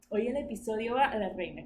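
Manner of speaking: ordinary talk, nothing marked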